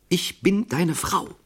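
high, slow threat with weight on each word; metallic, threatening. A narrator's reading. Strange woman in a quarrel, overheard, metallic and threatening. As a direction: draw out the menacing words, pitched well up. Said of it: "metallischen, drohenden Stimme vier Worte".

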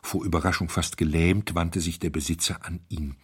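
ordinary delivery, nothing marked

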